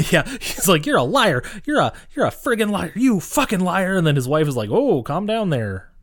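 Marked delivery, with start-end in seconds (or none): 0.8-4.0 high, indignant retold outburst; hammed-up outrage, pitched way up; mock angry accusation. Acts out customer accusing Jerry. 4.7-5.9 slow, higher-pitched mock soothing; hammed-up Midwestern wifely calming; imitated soothing wife. Voices wife soothing angry husband.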